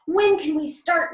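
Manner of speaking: eager. Acted. Nutmeg keen to help the Mildews, eager. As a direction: ask it bursting to begin. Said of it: "Nutmeg asked eagerly".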